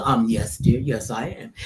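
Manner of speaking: low-voiced, gentle star's reply; James Earl Jones. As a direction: imitate a deep-voiced elder actor. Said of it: "And he goes".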